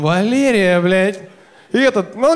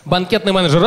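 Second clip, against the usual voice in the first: ordinary delivery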